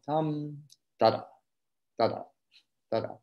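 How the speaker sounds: a small gap before each short note, then "da-da" dropped into it; lifted accent rhythm. Shown as a demonstration. Violin teacher showing how to lift before the short note. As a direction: leave a tiny hole before the accent in "Tam, da-da". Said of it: "create a little hole that you can drop the accent into".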